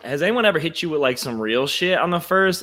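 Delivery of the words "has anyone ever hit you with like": ordinary talk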